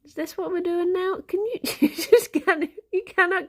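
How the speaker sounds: high, playful scolding pet voice; pet talk. Real, not acted. To her cat Fifi caught mid-crime, high teasing pet voice.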